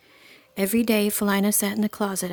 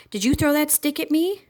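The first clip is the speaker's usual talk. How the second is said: accusing bully; 7 semitones higher, 4 dB louder than usual. A narrator's reading. Bully accuses girl in tree.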